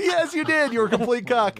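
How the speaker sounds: very high imitation of an affronted star; exaggerated, high-pitched comeback; mock haughty retort. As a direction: throw it back in a high put-on voice.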